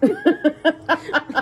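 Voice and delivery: a loud high laugh breaking out; burst of high laughter. Real, not acted. Laughs at mother's reply.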